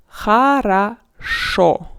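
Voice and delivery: хорошо slow and loud as "kha-ra-SHO"; first two o in хорошо as "ah", last one a full o; unstressed O as A. Shown as a demonstration. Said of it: "it's A. Like in a word".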